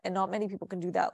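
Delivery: fast throwaway restatement; quick rushed repeat. Real, not acted. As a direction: rattle off 'And not many people can do that,' fast. Repeats the point, hurried.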